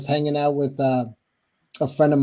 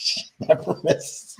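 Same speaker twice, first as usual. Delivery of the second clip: mock whisper; 6 dB quieter, slower than usual. Mock hushed echo.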